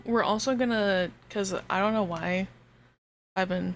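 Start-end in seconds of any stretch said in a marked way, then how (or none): none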